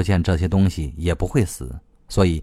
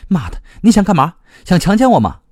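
high, loud outraged yell; angry roar; 9 semitones higher, 5 dB louder than usual. A narrator's reading. Pinned against the wall by the other, roaring. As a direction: roar it out in a raised pitch. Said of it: "他朝他大吼".

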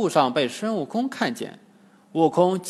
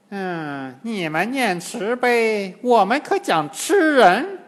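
high, slow, scornful demon crone; snort, then climb high and loud; snorting old demoness; 5 semitones higher, 4 dB louder, slower than usual. A narrator's reading. Old demoness mocking the monks' mercy, scornful drawl. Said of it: "金蝉老妖哼了一声说".

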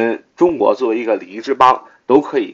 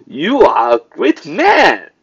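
slow, higher, drawn-out mock compliment; sarcastic; 6 semitones higher, 3 dB louder, much slower than usual. Shown as a demonstration. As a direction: stretch out the insincere praise. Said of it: "I asked sarcastically". (拖长音讽刺)

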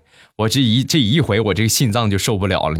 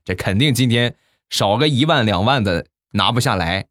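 ordinary talk, nothing marked

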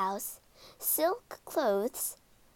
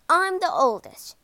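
grandly; 6 semitones higher, 7 dB louder than usual. A narrator's reading. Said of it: "said Yang grandly".

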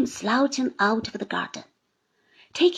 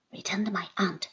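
usual speaking voice

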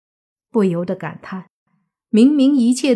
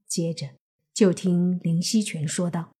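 usual speaking voice

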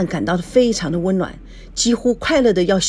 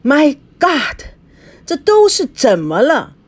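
loud, high cry of frustration; exasperated; 8 semitones higher, 4 dB louder, slower than usual. A narrator's reading. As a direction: burst out, at a loss over the mess. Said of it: "他紧紧地握着拳说". (懊恼地喊)

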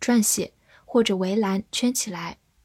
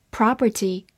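American "property" with an open ah; American accent. Shown as a demonstration. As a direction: say "property" the American way, PRAH-per-tee. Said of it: "美式发音".

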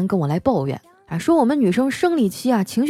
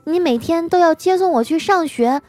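put-on high-pitched precocious child voice; unfazed little boy; 8 semitones higher than usual. Nephew calmly warning the waitress, childish voice. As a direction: reason it out like a composed small child. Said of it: "淡定地对服务员说".

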